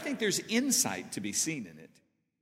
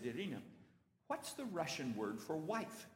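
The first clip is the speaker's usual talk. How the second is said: a softer, confidential question; hushed aside; 9 dB quieter than usual. Retold or imitated. Tourist quietly asks his guide for a word, hushed aside. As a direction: lean in and ask quietly, like a secret.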